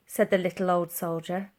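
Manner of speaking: usual speaking voice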